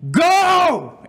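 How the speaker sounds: high, loud drawn-out scream; sudden piercing holler. Retold or imitated. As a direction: yell it out at full force, high and long. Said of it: "and I scream".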